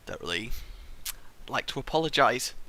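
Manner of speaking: quiet mumbled false start; muttered. Shown as a demonstration. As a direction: mumble it under your breath. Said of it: "I'd like to apologise".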